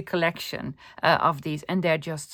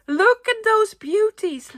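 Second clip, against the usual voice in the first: excited; 14 semitones higher, 4 dB louder, slower than usual. Real, not acted. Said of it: "really excited".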